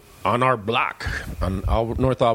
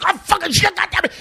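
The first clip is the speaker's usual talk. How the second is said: high, loud cursing outburst; temper flare; 3 dB louder, faster than usual. Retold or imitated. Douglas loses his temper fixing a bike, loud high cursing. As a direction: blow up mid-repair. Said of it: "his temper just flares".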